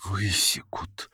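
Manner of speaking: whispered dread; frightened whisper. A narrator's reading. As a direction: breathe the word in terror. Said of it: "Опять шёпот, испуганный вид".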